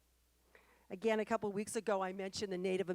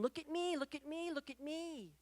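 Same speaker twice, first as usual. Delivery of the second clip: mock screaming; 8 semitones higher than usual. Speaker voices all of nature clamouring to be seen, mock screaming. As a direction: imitate nature calling out, pitched high without real volume. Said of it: "all of nature was screaming".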